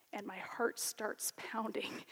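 slow hushed whisper, almost no voice; breathless whisper. Real, not acted. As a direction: draw them in with a slow whisper. Builds suspense before the meeting.

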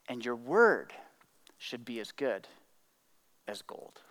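low, soft, spaced-out phrases; grave, fading almost to a whisper at the end; hushed solemnity. Acted. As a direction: let each phrase sink in quietly. Solemn close of quoted saying.

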